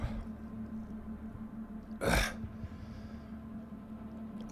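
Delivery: long unvoiced exhale of relief; long breathy sigh. Real, not acted. Taster recovers from pepper burn, long sigh. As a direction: blow out the burn slowly.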